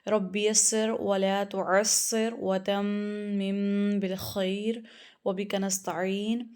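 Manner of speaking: slow, measured Quranic recitation; recited opening prayer. Real, not acted. Teacher opening class with a slow recitation. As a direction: recite the opening dua slowly.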